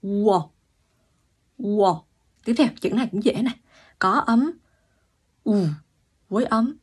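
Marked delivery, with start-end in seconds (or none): 0.0-2.0 Ua drawn from u into o; teacher's model Ua; Korean vowel ua. Shown as a demonstration. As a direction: glide Ua slowly for students. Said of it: "Từ u kéo sang o".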